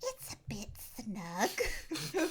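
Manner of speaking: slow, squeezed put-on voice; fat Chris. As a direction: draw it out haltingly, imitating an awkward movie character. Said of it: "eight octaves higher when he's fat Chris".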